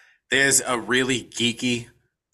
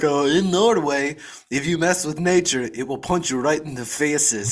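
mock Norwegian accent on a quoted warning; imitated Norwegian accent; 2 semitones higher than usual. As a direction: mimic a Norwegian cop's lilt. Imitating the film's Norwegian cop.

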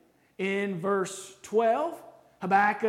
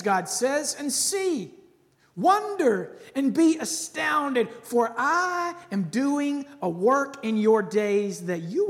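commanding divine voice; 3 semitones higher, 5 dB louder, slower than usual. Acted. Preacher reads God's reply to the prophet, proclaiming. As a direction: announce it like God thundering an answer.

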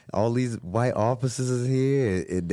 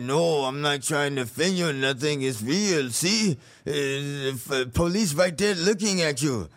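a hint of a Mexican passenger voice, a bit higher; lightly voiced passenger; 3 semitones higher than usual. Passenger on the bus warning about the police, lightly voiced. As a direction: casually warn him.

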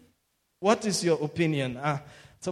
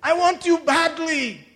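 higher, louder, longing call; God's voice; 10 semitones higher, 6 dB louder than usual. Retold or imitated. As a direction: voice God yearning to embrace you. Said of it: "He said".

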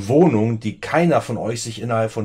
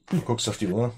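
ordinary talk, nothing marked

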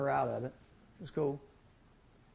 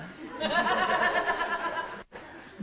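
high, delighted laugh of pure relief; joyful laugh. Acted. Father laughing as his son comes home. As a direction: laugh out with overflowing joy. Father overjoyed at son's return.

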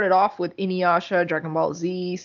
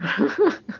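laughing; 4 semitones higher than usual. Real, not acted. Laughs after the joke.

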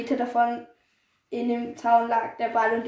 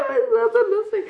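high-pitched, laughing protest; helpless laughter; 10 semitones higher than usual. Real, not acted. Friend defending her laughing at the story, cracking up. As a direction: squeal it out through giggles.

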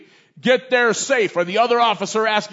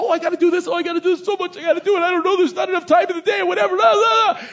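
frazzled worrier; 8 semitones higher, faster than usual. Retold or imitated. Mimicking an anxious overwhelmed woman.

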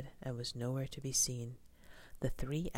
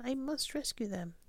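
high, urgent resolve; storybook heroine's high-pitched urgency; alarmed gasp; 11 semitones higher than usual. A narrator's reading. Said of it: "gasped Stella Luna".

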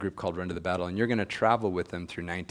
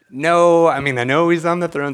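much louder and higher, an alarmed insistence; loud protest; 9 semitones higher, 14 dB louder than usual. Retold or imitated. Young man protesting his mentor's mock alarm, loud and high. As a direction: blurt it out as an urgent denial.

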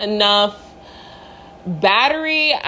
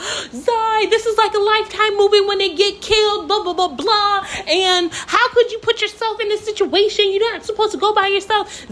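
high-pitched mock scolding; yelling lecture; 10 semitones higher than usual. Sister lecturing the lost hiker, high and scolding. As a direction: imitate a nagging sister's rant, pitched way up. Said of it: "literally lecturing me and yelling".

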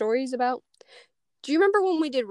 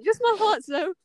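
polite Max; 7 semitones higher than usual. Retold or imitated. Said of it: "I love how polite he was with that. He was just like".

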